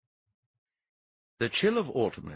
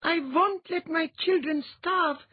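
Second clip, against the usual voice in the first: queen's vow; 5 dB louder, slower than usual. A narrator's reading.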